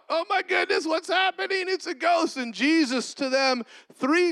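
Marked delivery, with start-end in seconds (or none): 0.1-2.4 high panicked cry, a ghost; freaking out. Acted. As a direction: shriek it up high in mock panic. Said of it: "they rightfully freak out".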